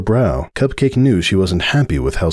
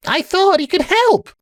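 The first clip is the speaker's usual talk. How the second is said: protesting. A narrator's reading. Sunburst answering Starlight's reproach, high protest. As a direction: plead your case, pitched way up. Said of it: "Sunburst protested".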